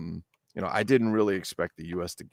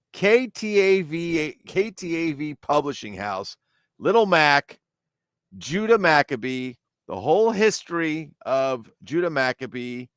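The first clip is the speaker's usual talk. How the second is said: high, showy product announcement; announcer; 7 semitones higher than usual. Acted. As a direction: present the title like a showman.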